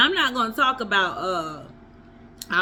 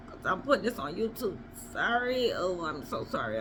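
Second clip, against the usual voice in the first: ordinary delivery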